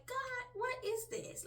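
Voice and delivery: high, slow, baffled question; wondering. Retold or imitated. Woman puzzling over the dreams, high wondering exclamation. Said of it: "I was still wondering".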